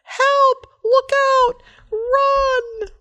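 high, loud warning shouts; yelling. Acted. Tony warning everyone as he careens, high loud shouts. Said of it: "he yelled".

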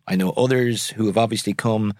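ordinary talk, nothing marked

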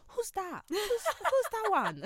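imitating her confused mum; puzzled mum. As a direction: play the clueless mum asking. Mum voiced in the story.

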